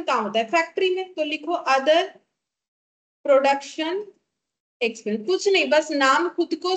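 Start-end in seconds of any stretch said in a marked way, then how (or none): 1.7-5.2 one word, then a long pause; slow dictation. Real, not acted. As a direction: dictate word by word for note-takers. Dictates item after "write.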